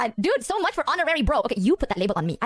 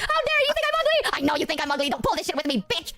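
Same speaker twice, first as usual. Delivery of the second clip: mock hysterical girl; 3 semitones higher than usual. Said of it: "And they're like".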